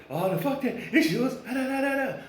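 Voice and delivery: lightly voiced woman waving it away; mock indignant woman. Woman brushing off the paternity question, mock huffy and high. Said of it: "She be like".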